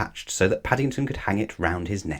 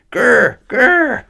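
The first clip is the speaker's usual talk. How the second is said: much higher, louder muffled growl; furious spluttered grr; 10 dB louder than usual. A narrator's reading. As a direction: splutter in rising fury. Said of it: "said Missus Bird".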